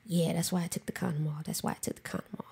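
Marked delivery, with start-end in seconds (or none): 1.4-2.4 fast, breathless whisper of his words; whispered repeat. Retold or imitated. Echoing her partner's words to a friend, whispered. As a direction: let it slip out under your breath.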